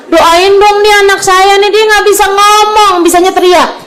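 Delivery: high-pitched mimicked parental complaint; complaining parent. Relative asks for prayers for her child, mock complaining. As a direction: imitate a parent wailing for help, voice pushed high.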